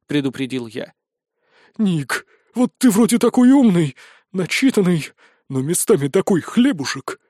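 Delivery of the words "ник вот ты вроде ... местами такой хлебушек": mildly quavering character voice; trembling little voice. A narrator's reading. Pudgy boy wizard chides his friend from the lava, trembling little voice. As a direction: answer with a slight, timid tremble. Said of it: "трясущимся голоском ответил мальчик-волшебница-фея".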